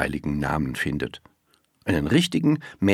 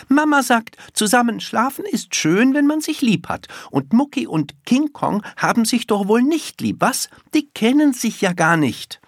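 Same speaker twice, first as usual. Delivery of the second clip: put-on high, loud child laying down her mother's wisdom; small girl arguing with full conviction, pitched right up; high, insistent childish lecture; emphatic little girl, indignant; 4 dB louder than usual. A narrator's reading. Little girl's held dialogue voice.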